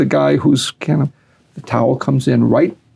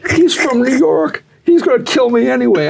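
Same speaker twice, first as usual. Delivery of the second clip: imitated panic; 10 semitones higher than usual. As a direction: play the kid pleading, pitch way up. Retold terrified fighter protesting.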